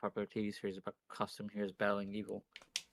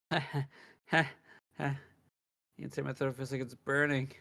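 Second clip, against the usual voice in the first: plain reading voice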